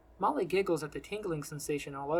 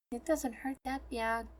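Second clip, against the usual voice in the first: put-on high, slow little-girl voice; small child's voice, drawn out; 7 semitones higher, 3 dB quieter, slower than usual. A narrator's reading. Sick girl tells a kind stranger about her pain, little-girl voice. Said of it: "he asks the little girl".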